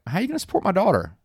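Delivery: stern father. Retold or imitated. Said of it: "So he's like".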